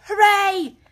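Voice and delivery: high, loud shout of glee; joyful cry. A narrator's reading. Rat cheers at his find in the snow. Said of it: "Suddenly the rat cried".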